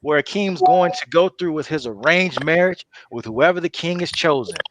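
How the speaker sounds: ordinary delivery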